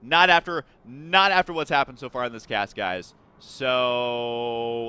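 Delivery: usual speaking voice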